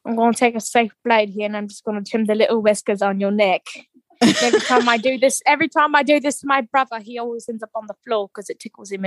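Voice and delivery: put-on British accent, running on quickly. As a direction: imitate a chatty British hairdresser. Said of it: "he loves a good British accent".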